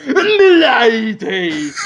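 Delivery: hammed-up bowing gentleman, very slow; mock gentleman's greeting. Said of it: "bow down and go".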